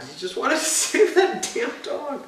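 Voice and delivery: high, drawn-out, laughing lament; mock wail. Lamenting the dog rescue scene, mock wail. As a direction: whine it out slowly in exaggerated grief.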